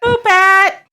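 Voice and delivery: high, squealed little cheer; comic high-pitched echo; playful exclamation. Pretend. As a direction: call it out in a high, silly voice. Playfully echoes the other's phrase.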